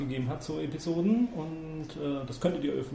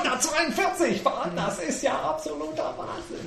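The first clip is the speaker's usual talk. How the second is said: mock amazement; 11 semitones higher than usual.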